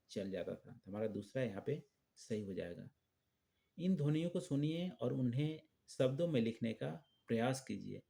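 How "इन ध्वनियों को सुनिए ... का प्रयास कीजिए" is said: normal talking voice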